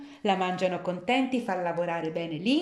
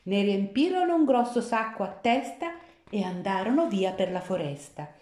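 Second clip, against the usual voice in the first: ordinary delivery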